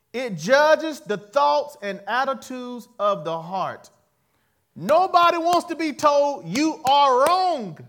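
loud, slow, building sermon cry; full-force preacher's call; preaching. Acted. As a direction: drive it home from the pulpit.

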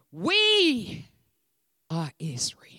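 ordinary talk

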